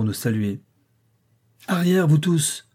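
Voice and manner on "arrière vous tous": high-pitched cry to stand back; exclaimed command. A narrator's reading. Master drives the welcoming household back, crying out. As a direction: call out an order to everyone. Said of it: "s'écria le maître".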